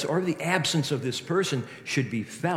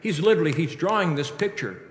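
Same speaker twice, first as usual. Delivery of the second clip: ordinary delivery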